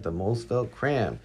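usual speaking voice

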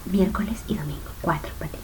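hushed, unvoiced whisper; susurro. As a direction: whisper the reminder very softly. Repeats the reminder in a whisper. (susurrando muy bajito)

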